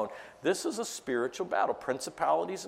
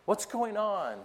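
much softer, bewildered; soft puzzled question; 3 semitones higher, 5 dB quieter than usual. Retold or imitated. Couple quietly asking each other why they fight. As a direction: ask it quietly, confused. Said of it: "we look at each other and say".

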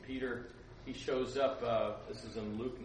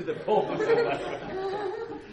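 much higher, slow, laughing whine; mock high whine; 9 dB louder, slower than usual. Preacher mocking a listener's complaint in a high laughing whine. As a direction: mimic it high and slow, amused. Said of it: "laughs, repeats it".

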